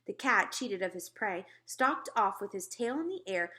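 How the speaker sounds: ordinary delivery, nothing marked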